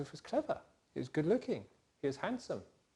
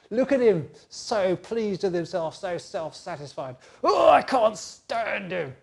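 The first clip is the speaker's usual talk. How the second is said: louder, higher, drawn-out disgust; exasperated outburst; 4 semitones higher, 7 dB louder, slower than usual. Acted. Brothers venting loudly about Joseph, exasperated. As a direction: let the contempt burst out.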